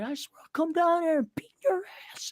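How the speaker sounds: hissed, whispered threat to the boss. Retold or imitated. Angry worker threatening the boss over the phone, whispered threat. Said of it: "you gotta be careful with your words 'cause it's like".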